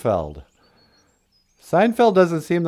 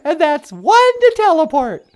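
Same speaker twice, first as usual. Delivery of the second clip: much higher and louder, giddy; the girlfriend beaming him up, laughing; high excited lady voice; 7 dB louder than usual. Pretend. Natasha laughing.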